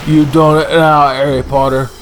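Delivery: hammed-up Hagrid; gruff Hagrid scolding. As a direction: tell him off like Hagrid. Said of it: "He's like".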